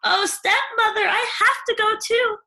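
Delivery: put-on high, loud begging girl; Cindy begging her stepmother; pleading Cindy. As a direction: plead to go to the ball. Cindy begs.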